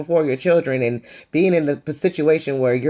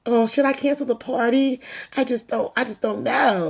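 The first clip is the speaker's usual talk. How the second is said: higher and softer, whiny mock hand-wringing; mocking Lisa again; 8 semitones higher, 3 dB quieter than usual. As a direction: make her fake worry sound silly. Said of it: "talking about".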